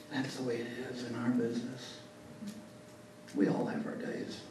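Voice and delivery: very soft, half-whispered reflection; hushed, wistful Reagan. Retold or imitated. Reagan muses to Tip over drinks, hushed and wistful. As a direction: murmur it over the ice in your glass.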